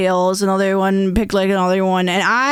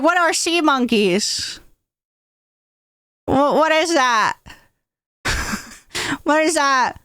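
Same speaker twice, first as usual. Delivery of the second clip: put-on higher, puzzled child; little kid voice; 5 semitones higher than usual. Little Vanny asks about sea monkeys, puzzled child. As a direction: play the clueless little kid.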